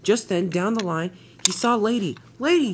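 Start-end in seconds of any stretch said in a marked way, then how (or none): 2.4-2.7 high, loud gasp of surprise; startled, pitch leaping up. Acted. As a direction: cry the name in astonishment. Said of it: "he gasped".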